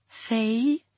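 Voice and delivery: lisping. Acted. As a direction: say it with a lisp. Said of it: "she lisped".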